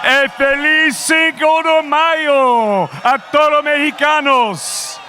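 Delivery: booming Spanish ring announcer call. Acted. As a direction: shout it out to the arena.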